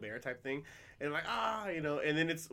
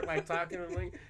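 normal talking voice

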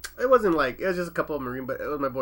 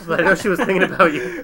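normal talking voice

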